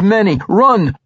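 high, loud warning shouts; urgent cry. A narrator's reading. Monk warning his ally to flee, crying out. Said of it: "he cried".

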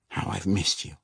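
soft, tender longing. Acted. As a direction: say it softly to her. Regis tender to his wife.

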